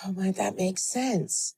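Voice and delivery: soft, slow sigh of relief; relieved exhale. Acted. Kids finally understanding the starting point, voiced with soft relief. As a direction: say it quietly and slowly, like air leaving a balloon. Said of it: "the air's let out of the balloon".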